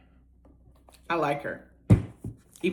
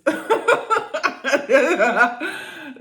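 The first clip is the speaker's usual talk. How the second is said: high cackling laugh; real disbelieving laugh; loud high laughing fit; loud burst of laughter; 10 semitones higher, 9 dB louder than usual. Real, not acted. Reacting to wild story.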